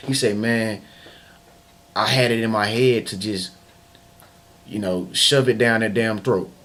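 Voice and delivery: usual speaking voice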